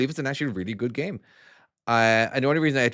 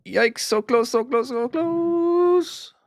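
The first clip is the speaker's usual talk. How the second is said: high drawn-out yikes; 13 semitones higher, 4 dB louder, much slower than usual. Real, not acted. Near miss while driving truck.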